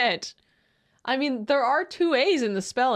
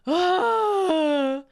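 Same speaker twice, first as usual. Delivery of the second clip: long, high drawn-out sigh; exasperated groan; 9 semitones higher, 4 dB louder than usual. Real, not acted. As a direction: let out a high, wailing sigh. Exasperated after posting the comment.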